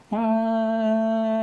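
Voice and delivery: loud, high, steady "aah" drone; approaching-vehicle noise. Shown as a demonstration. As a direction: imitate an oncoming train's high held tone. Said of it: "the sound that you hear is".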